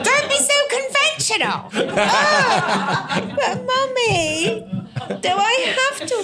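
put-on high, drawn-out kid's plea with a laugh; very high whiny child, slow on "Mummy"; child's whine. Child voice answering mum.

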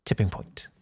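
very soft, low, nearly whispered; hushed repeat. Real, not acted. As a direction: let it drop away under the breath. Deadpan repeat of the punchline.

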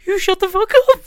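very high, loud, hammed-up wail; mock tearful shout. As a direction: squeal it like someone comically bursting into tears. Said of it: "holding back tears".

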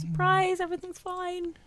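high sing-song reassurance. Pretend. Mock cheerful reassurance.

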